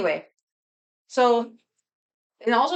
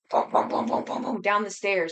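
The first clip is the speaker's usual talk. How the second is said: stomping noises. Retold or imitated. Imitating neighbor stomping downstairs.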